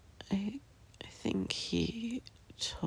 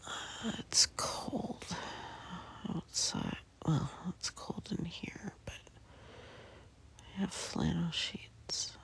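whispering. Real, not acted. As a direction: whisper it all, barely voiced. Whispers from bed throughout.

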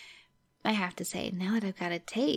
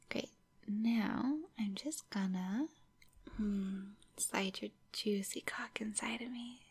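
sultry whisper. Acted. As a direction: murmur it close to the ear, teasing. Intimate roleplay held throughout.

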